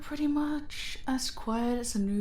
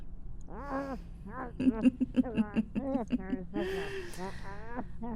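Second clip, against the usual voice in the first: drawn-out mocking laugh, higher; long villain laugh; 3 semitones higher, 4 dB quieter than usual. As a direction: laugh as if it's all a game.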